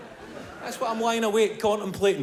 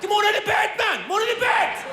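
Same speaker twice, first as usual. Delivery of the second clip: calling phone; 14 semitones higher than usual. Acted. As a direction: yell like a phone luring him over. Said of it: "your phone in the corner going".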